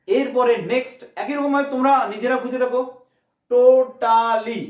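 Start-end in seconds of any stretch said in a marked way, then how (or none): 3.5-4.6 টো-টা-লি, slow and loud; three drawn-out syllables on টোটালি. Shown as a demonstration. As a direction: stretch টোটালি into three strong beats. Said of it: "এরকম তিন প্রকারের শব্দ".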